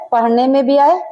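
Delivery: ordinary talk, nothing marked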